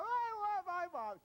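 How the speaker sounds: high, loud mock kid's wail; hammed-up child's cry for mom; yelling child. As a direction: yell it like a bawling little boy. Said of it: "Kid's still yelling".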